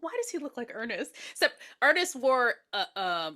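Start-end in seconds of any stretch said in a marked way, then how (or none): 0.0-1.1 hushed, voiceless baffled aside; retold whisper. Retelling her reaction to the killer's costume, whispered. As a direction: whisper it like a private question.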